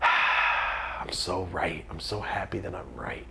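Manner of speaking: very slow whispered gloat; quiet inner gloating about being right; smug whisper. Retold or imitated. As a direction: savour it slowly in a whisper. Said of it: "one of those things in myself".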